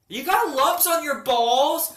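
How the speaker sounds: imitating mom blurting it loudly, high-pitched; loud, high, drawn-out incredulous question. Mom blurts out the symptoms in Home Depot, loudly. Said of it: "She says it so loud".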